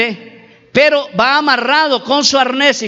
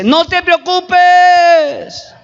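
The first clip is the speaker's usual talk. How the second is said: high, drawn-out shout from above; booming reassurance called from far up; 6 semitones higher, 4 dB louder, much slower than usual. Retold or imitated. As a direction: call down slowly, stretching the last word. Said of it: "la voz le dice".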